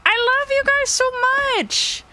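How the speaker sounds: choked up, pitch breaking upward; emotional. Real, not acted. Thanking subscribers, overcome with real emotion. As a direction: voice cracking up high with feeling. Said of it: "ah, I'm so emotional".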